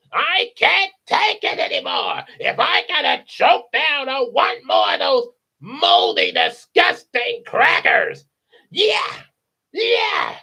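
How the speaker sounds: loud, high screeching rant; fed-up parrot squawking at full tilt; Gilbert Godfrey. Retold or imitated. Parrot complains about moldy crackers, screechy comedian voice. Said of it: "if it was Gilbert Godfrey as the parrot".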